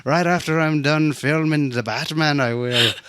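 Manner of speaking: mock Irish accent. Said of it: "We're sounding Irish".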